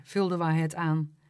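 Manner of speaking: ordinary delivery, nothing marked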